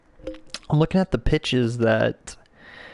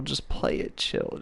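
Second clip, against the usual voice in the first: whispered advice; 7 dB quieter, slower than usual. Shown as a demonstration. Advice to viewers, said twice.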